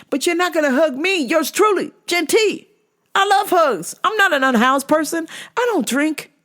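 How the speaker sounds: high-pitched comic pleading rant; mock indignation. Teller begs for a hug too, high mock indignation. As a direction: protest up high, wounded and jealous.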